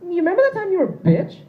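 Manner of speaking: high, loud mocking princess voice; mocking girlfriend. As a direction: needle him like a spoiled princess, way up high. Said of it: "she's just gonna pull out that card and be like".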